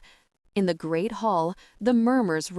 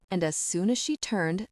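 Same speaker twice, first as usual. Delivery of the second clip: ordinary delivery, nothing marked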